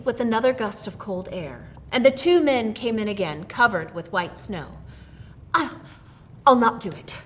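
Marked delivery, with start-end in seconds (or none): none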